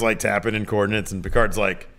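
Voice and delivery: normal talking voice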